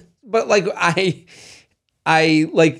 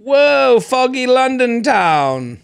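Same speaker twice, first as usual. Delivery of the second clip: slow, higher mock posh London accent; playful put-on British accent; 7 semitones higher, much slower than usual. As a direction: drawl it like a stage Londoner. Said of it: "That's exactly how they say it".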